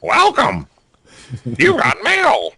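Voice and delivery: high chirpy computer greeting; AOL voice. Retold or imitated. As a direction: announce it like the old AOL box.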